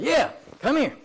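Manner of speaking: high, impatient imitated order; witchy, rude impression; shrilly witchy old lady. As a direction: play a bossy old woman summoning someone. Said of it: "kind of wicked witch of the west, shrilly voice".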